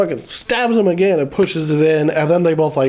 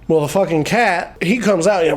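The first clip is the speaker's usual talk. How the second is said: usual speaking voice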